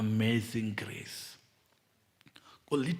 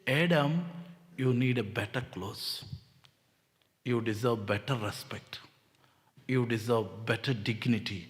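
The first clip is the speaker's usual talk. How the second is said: tender God voice; 4 semitones lower, 3 dB quieter than usual. Acted. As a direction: speak to Adam like a loving father. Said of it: "He says".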